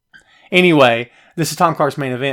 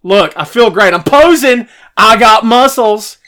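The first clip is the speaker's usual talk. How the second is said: loud, high showing-off voice; hammed-up posing brag; mock muscle bravado; 7 semitones higher, 10 dB louder, slower than usual. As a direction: strut like you're flexing for a camera.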